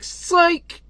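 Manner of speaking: high-pitched gotcha cry; gleeful taunt. Acted. Son fooling a parent before bolting, high taunting cry. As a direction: toss out a high, teasing "psych".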